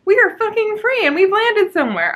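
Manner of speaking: high, loud, bubbly mock cheer; hammed-up sunny prisoner; chipper. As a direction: parody a relentlessly perky girl. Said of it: "so, like, chipper".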